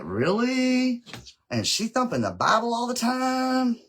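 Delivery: high, drawn-out mock "oh, really?"; mock disbelief. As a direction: draw it out high in exaggerated disbelief. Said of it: "I'm like".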